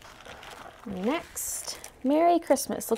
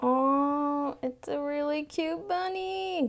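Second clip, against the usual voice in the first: ordinary talk, nothing marked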